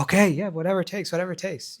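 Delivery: high, hurried-sounding yielding; flustered agreement. Acted. Mother-in-law yields to the snake doctor, mock flustered.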